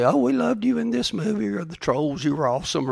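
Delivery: high, gushing fan voice; mock gushing fan. Preacher mocks fans praising a celebrity, high and gushing. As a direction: gush over him like a starstruck fan. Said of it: "she didn't say".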